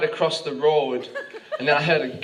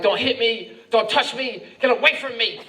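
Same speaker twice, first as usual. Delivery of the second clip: put-on slightly raised-pitch girlfriend protest; mock yelling; 5 semitones higher than usual. Girlfriend telling him to get away, mock yelling. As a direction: yell it as an indignant girlfriend, lightly hammed. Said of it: "she started yelling at me".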